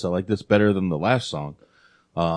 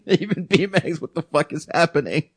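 laughing; 9 semitones higher than usual. Real, not acted. Laughing disbelief at a song.

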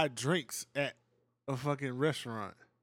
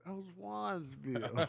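slow rapper-style line; 2 semitones higher, 5 dB quieter, slower than usual. Retold or imitated. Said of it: "that's a [name] line".

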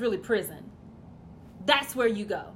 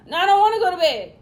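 put-on fast, higher whining child; whiny kid protest; 6 semitones higher, 8 dB louder, faster than usual. Child refusing bedtime to his dad, fast whine.

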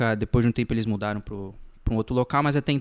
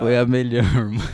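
real laughter breaking through the words; words squeezed out between laughs; crying with laughter; 5 dB louder than usual. Real, not acted. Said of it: "Eu tô chorando de rir".

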